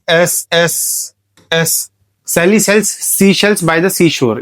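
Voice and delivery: "एस" and "sells" with a long hissed s, very slow; hissing s demo. Shown as a demonstration. Teacher hissing each s so students hear the repeated sound. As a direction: hiss every s sound, drawing it out. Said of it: "की sound आ रही है हर जगह".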